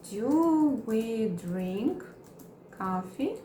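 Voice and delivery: very slow, chunked; slow teaching read; slow model question. Shown as a demonstration. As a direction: drag it out for learners. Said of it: "Vamos criar mais exemplos".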